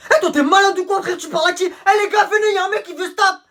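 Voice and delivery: high, loud hyped-up call; teen shouting to mates that a fight is on; calling the gang. Acted. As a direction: rile up the crowd for a fight. Pupil rallies friends to fight.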